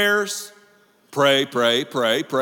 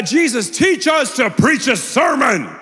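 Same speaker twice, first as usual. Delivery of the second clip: hammed-up shouted plea to Jesus; mock loud request; 6 semitones higher, 6 dB louder than usual. Pastor mocking disciples, a hammed-up shouted request. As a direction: call it out like a showy disciple.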